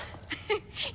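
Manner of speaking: soft breathy high giggle; teasing laugh. Acted. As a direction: laugh at him for jumping. Teasing laugh at startled friend.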